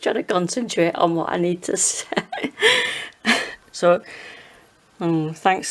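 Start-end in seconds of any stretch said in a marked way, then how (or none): 2.1-3.7 high giggling laugh. Real, not acted. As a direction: burst out laughing. Laughing at son's tip.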